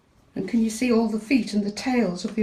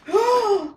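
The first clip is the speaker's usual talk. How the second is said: big high sharp intake of breath; dramatic gasp; 9 dB louder than usual. Acted. Reading to a child as the villain cat appears, hammed-up gasp. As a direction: gasp as if the cat just jumped out.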